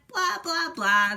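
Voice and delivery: mocking, careless blah blah blah, last one loud; dismissive blah blah. A narrator's reading. The bad eggs brushing off the good egg, mocking and dismissive. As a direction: brush him off like rude eggs. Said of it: "they replied".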